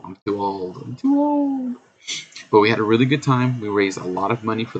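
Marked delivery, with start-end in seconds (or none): 0.8-1.8 very high, slow whiny squeak; squeaky old voice. Pretend. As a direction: whine it in a tiny high voice. Repeats complaint in put-on voice.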